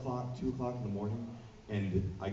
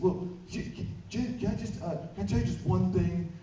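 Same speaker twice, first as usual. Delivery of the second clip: stammering fan plea; 11 semitones higher, 5 dB louder than usual. Retold or imitated. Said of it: "And she's like".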